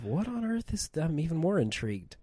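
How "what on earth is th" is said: baffled. Retold or imitated. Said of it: "I was saying to myself".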